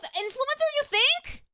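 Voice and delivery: very high, incredulous squeak; mock disbelief. Streamer playfully doubting she has the accent, mock disbelief. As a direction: question it in a put-on high voice.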